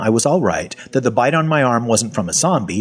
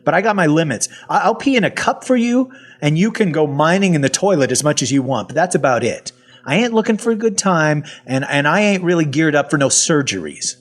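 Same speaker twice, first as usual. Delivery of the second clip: higher-pitched, wary objection; protesting; 6 semitones higher than usual. Acted. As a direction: push back and set your limits, pitch jumping up. Narrator acts out protesting to researcher.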